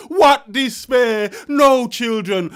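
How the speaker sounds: loud, slow cry of despair; anguished outcry. Acted. Poet to audience, a slow loud cry of despair. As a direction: wail it out slowly to the crowd.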